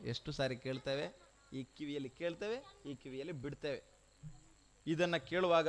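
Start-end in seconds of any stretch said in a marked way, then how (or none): 0.0-3.9 hushed, lower voice after the climax; quiet reproach. Real, not acted. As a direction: chide the listeners very softly. (ತಗ್ಗಿದ ಧ್ವನಿಯಲ್ಲಿ ಆಕ್ಷೇಪ)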